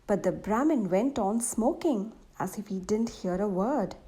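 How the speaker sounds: plain reading voice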